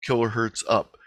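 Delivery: ordinary talk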